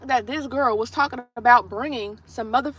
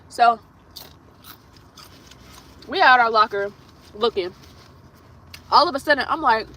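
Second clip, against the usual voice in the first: usual speaking voice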